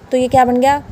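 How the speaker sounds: ordinary talk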